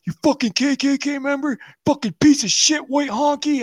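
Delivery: high-pitched imitation of a yelled insult; mocking taunt. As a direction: jeer it high, like a coward from afar. Friend hurling insults as teller leaves.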